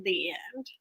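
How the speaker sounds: soft whisper to close the story; whispered the end; whispered sign-off. Real, not acted. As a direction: breathe it out as a playful ending. Closing her story.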